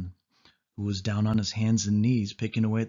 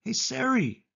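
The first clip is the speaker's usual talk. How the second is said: higher, anxious call after her; 13 semitones higher than usual. A narrator's reading. The boy calls to Sari ahead in the tunnel, anxious. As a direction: call after her as she pulls away. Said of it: "I called".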